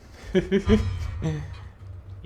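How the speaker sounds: high, loud whispered laugh; loud breathy laugh. Real, not acted. Player knows he is about to die, laughing out loud. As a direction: laugh out at the hopeless spot. Laughs at his own coming death.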